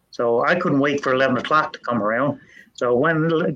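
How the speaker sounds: ordinary delivery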